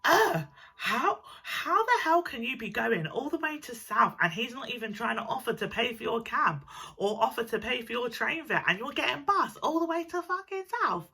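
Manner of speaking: high-pitched, incredulous retold rant; outraged friend. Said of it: "and she was like".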